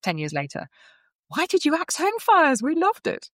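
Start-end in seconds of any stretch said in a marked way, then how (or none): 1.3-3.3 much higher, drawn-out mock lament of a fan; pleading complaint. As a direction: wail it slowly like a disappointed fan. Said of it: "people still ask her to this day".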